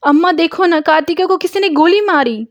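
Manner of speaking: high, loud urgent cry; frantic shout. Acted. Murthy cries to his mother, high and loud. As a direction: blurt the news out in panic.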